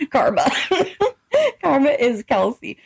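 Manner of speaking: ordinary talk, nothing marked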